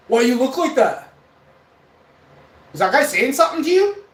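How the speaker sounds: loud, high acted-out challenge; protective demand. Big buddy spots scared kid on phone, loud demand.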